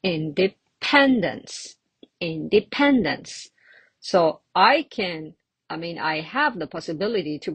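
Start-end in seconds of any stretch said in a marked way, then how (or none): 0.0-3.5 Independence with PEN stressed; teacher's model of indePENdence; stress on third syllable. Shown as a demonstration. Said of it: "the syllable with the typo".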